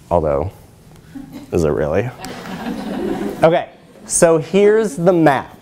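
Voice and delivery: ordinary talk, nothing marked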